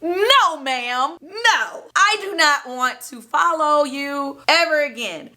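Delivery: high, emphatic mock refusal; hammed-up "absolutely not" delivery; acted firm refusal.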